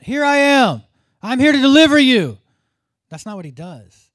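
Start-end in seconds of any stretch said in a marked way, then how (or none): 0.0-2.4 full high-pitched shout; yelling out. Acted. Preacher imagining God yelling to be noticed, loud high yell. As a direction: yell it out like a booming announcement from God. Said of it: "Why isn't he yelling out".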